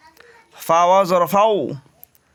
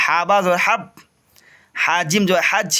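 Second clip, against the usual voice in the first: teacher chanting the letter drill; spelling drill; 2 semitones higher than usual. Shown as a demonstration. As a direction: sound out each syllable for students. Repeat from the start.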